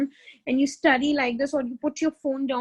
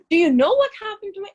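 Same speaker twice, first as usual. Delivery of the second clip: higher, louder, fast and dramatic; dramatic complaining; 6 semitones higher, 5 dB louder, faster than usual. Shown as a demonstration. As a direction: rush it out like a self-pitying drama queen. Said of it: "ऐसे नहीं बोलते".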